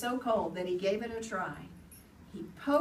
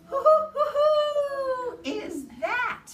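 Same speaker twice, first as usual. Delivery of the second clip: high hooting owl call, louder; 9 dB louder, slower than usual. Acted. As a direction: hoot it out like an owl.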